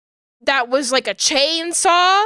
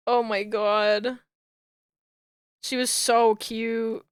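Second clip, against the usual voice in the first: much softer, lower, fond; soft adoring; 3 semitones lower, 6 dB quieter than usual. Real, not acted. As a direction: coo it tenderly.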